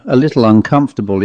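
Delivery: usual speaking voice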